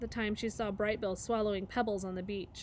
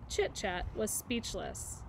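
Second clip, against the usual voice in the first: ordinary delivery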